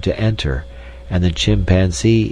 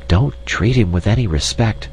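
ordinary delivery, nothing marked

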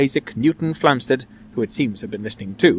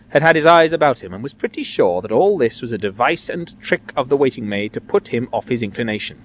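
ordinary delivery

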